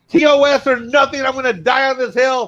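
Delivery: high, emphatic mock vow; stubborn fan declaration. Fan insisting on the original series, high emphatic vow. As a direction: declare it like a diehard.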